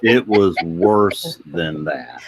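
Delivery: mock-solemn, stretched-out repeat; slow deadpan insistence. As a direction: drag it out like a grumpy old-timer. Host repeats the line deliberately to Bonnie.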